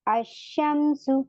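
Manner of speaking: अशमसु very slow, with the a going straight into a doubled sh; silent lam. Shown as a demonstration. Teacher shows the students a sun letter. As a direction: stretch अशमसु without any l. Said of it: "लाम को हमने silent कर दिया".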